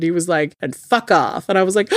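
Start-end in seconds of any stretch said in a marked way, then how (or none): none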